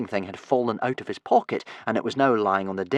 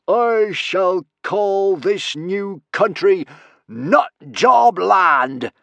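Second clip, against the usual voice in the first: loud, high, slow proclamation; pompous villain announcing in triumph; self-satisfied Norbert; 11 semitones higher, 7 dB louder, much slower than usual. Acted. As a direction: proclaim it grandly, drawing out each word. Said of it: "said Norbert with satisfaction".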